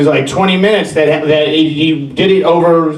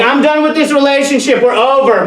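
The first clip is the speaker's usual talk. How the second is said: higher-pitched, lightly acted exasperation; mock angry breakup; 9 semitones higher than usual. Girlfriend dumping the Lego builder, mock fed-up.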